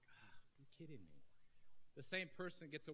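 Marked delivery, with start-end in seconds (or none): 0.1-1.2 softer, let-down mutter; mock disappointed aside; deflated. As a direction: let the air out of it. Deflated reaction in acted scene.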